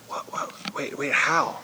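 very soft stammered double take; bewildered. Acted. Listener puzzled by Paul's words to the church, bewildered. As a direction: stutter it under the breath, baffled.

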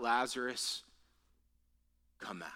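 soft, low call sinking to a whisper; quiet retold summons, nearly whispered; hushed command. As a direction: say the command gently, with a pause after the name. Same command repeated, now hushed.

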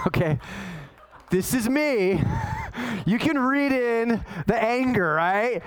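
ordinary talk, nothing marked

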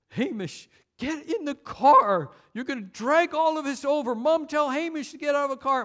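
put-on high, loud, panicky child's whine; a scared little brother begging his brother to get back in; whining kid. Little brother whining at his brother and mom, high and loud. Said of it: "I was, like, whining and saying".

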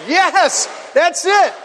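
high, excited shout; rousing praise. Real, not acted. Speaker to audience chanting along, elated approval. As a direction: cheer the crowd for joining in.

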